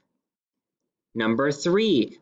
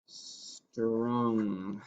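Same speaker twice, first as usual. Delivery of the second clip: "strung" said much softer, drawn out; soft close listening; 3 semitones lower, 10 dB quieter than usual. Shown as a demonstration. Teacher softly modelling "strung" for listening. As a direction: let "strung" fade almost to nothing. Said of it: "Let's listen close to the first part".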